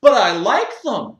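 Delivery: high, loud self-righteous whine; whiny gym member. Acted. Member defends his mess to the owner, loud high whine. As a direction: complain like a spoiled man-child.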